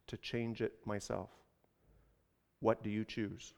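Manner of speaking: low, soft, measured speech; subdued, unhurried firmness; calm detachment. A narrator's reading. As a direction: quietly lay out the choice with pauses. Man calmly refuses the burden.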